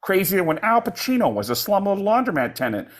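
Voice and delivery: hammed-up animated dad impression; Peter. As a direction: push it up high in a cartoon voice. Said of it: "Peter character. We'd say".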